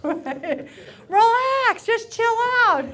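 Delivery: high, loud yell to chill out; exasperated mock yell. Man to an upset woman, hammed-up exasperated yell. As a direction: holler it as a hammed-up order.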